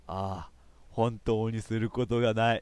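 slow, low, hammed-up despair; mock-tragic slow groan of boredom; full of pathos. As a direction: sigh it out, drawn out and hopeless. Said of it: "悲壮感あふれたコメント".

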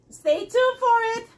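ordinary talk, nothing marked

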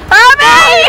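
high, loud call across the street; shouting out to a friend; shouted name. Real, not acted. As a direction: yell her name to get her attention. Calling out to a friend.

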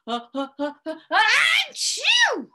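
stuttering build-up to a high, loud achoo; gigantic pretend sneeze. A narrator's reading. Storyteller voicing the snowman's sneeze, huge pretend achoo. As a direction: build in little breaths, then explode. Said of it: "The snowman sneezed a gigantic".

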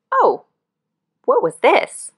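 high, startled gasp of a question; suddenly face something enormous; surprised. A narrator's reading. A huge creature appears.

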